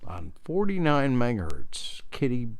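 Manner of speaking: ordinary talk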